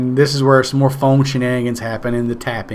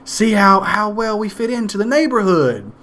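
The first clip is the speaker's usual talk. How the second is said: higher, sing-song proud mother; mock sweet mom; 7 semitones higher than usual. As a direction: play the pleased suburban mom showing off. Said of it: "she's like".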